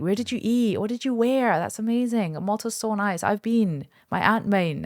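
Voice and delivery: high, bright, gushing imitation of fans; gushing mock enthusiasm. Mimicking people gushing over her Malta holiday, high and excited. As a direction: squeal over the Malta trip like an excited follower.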